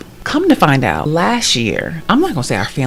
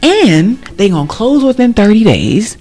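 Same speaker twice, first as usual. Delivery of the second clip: high, loud triumphant promise; God speaking; 5 semitones higher, 5 dB louder than usual. Retold or imitated. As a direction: announce the blessing with rising joy. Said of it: "But God was like".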